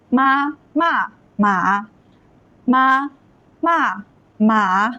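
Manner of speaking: very slow, each syllable held; deliberate teacher's model reading; tone demonstration. Shown as a demonstration. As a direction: stretch out each Mandarin tone. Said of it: "notice that the tones are changing".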